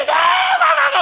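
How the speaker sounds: high loud exaggerated wail; mock wailing cry. Shown as a demonstration. Mocking noisy prayer to the congregation, loud wail. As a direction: wail it out in parody of showy prayer. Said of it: "하지 마시고".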